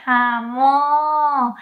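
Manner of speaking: fond, mild "Ah, mo"; loving mo. Shown as a demonstration. Teacher shows a fond, loving mo. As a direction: scold someone affectionately. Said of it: "You can say lovingly".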